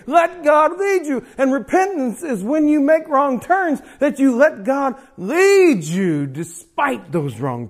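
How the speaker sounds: loud, high, impassioned preacher's climax; full-force preaching. Acted. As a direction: preach it hard to the congregation.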